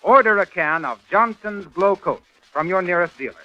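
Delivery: normal talking voice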